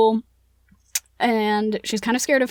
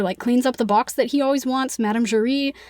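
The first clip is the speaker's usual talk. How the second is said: ordinary talk, nothing marked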